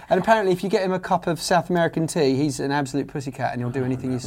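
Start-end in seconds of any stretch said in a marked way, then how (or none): none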